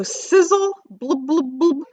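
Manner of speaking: quick recap of the noises; echoed sound words. Acted. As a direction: replay the kitchen sounds playfully.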